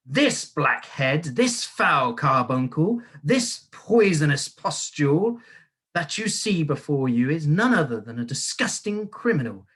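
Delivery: booming tirade. A narrator's reading. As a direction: savour every slur like a prosecutor. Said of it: "boomed the headmistress".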